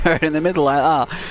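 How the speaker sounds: ordinary talk, nothing marked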